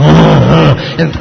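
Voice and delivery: dad's pretend bear growling; rumbling, wordless mock-bear growls; playful bear growl. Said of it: "walking around on all fours going".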